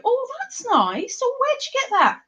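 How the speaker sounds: admiring onlooker. Pretend. Imagined passerby admiring her handmade clothes, high admiring gush. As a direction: gush in an impressed, swooping high voice. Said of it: "somebody see me and go".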